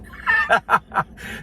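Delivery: a burst of high real laughter; a sudden squealing laugh. Real, not acted. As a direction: crack up at the memory. Laughing at the story.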